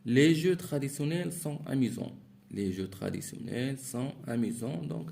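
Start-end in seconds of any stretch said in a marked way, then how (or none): 0.0-2.1 higher and louder, read out to the class; reading aloud. Shown as a demonstration. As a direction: announce the example sentence clearly. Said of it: "teacher reads the exercise sentence". (lecture à voix haute, plus forte et plus aiguë)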